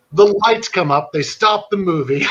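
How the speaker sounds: normal talking voice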